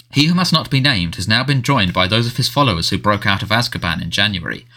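ordinary delivery

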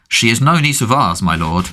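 ordinary delivery

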